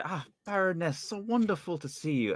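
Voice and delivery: light mock-gracious courtier; gushing greeting. Player greeting the baroness, lightly gushing. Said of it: "I walk up to her and say".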